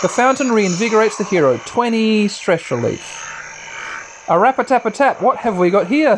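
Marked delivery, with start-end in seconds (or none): 4.3-6.2 high playful taunt; sing-song villain taunt; character voice. Retold or imitated. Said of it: "says Logothor".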